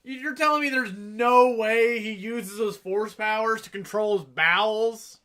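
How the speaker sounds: loud, high, slow disbelief; he has the Force, come on!; incredulous. Real, not acted. Mock outrage about Yoda's powers.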